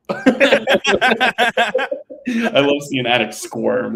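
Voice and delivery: high, slow words through real laughter; laughing. Real, not acted. Laughing at the host squirming.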